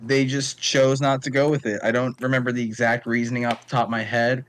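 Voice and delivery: ordinary talk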